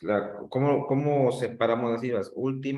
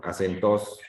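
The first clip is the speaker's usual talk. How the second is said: "Acentos" as a-cen-TOS; stress on last syllable; 2 semitones lower than usual. Shown as a demonstration. Teacher showing a nonexistent word, "Acentos" stressed on "tos". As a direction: land hard on the final "tos" of "Acentos". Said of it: "eso pues tampoco existe".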